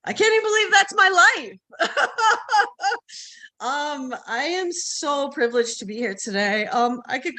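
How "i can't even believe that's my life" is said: high, loud laugh breaking into the words; giddy disbelieving laughter; laughing in disbelief. Real, not acted. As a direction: laugh it off, thrilled and a little embarrassed. Overwhelmed speaker laughing at her own bio.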